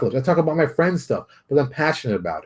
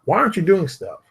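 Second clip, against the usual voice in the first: ordinary talk, nothing marked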